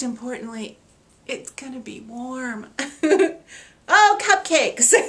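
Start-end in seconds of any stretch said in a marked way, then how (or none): 3.9-5.1 delighted squeal. Real, not acted. Spotting cupcakes on the quilt.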